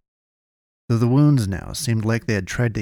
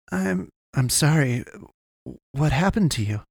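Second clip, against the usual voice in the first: higher-pitched, hesitant questioning; pressing on; 5 semitones higher than usual. A narrator's reading. Boy questioning the dying man, pressing on. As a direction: press on gently in a raised pitch. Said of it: "Kalen pressed on".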